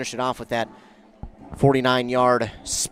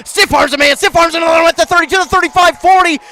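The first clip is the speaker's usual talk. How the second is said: rising, fast, loud play call; excited call; 9 semitones higher, 11 dB louder than usual. Real, not acted. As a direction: call the run as it breaks.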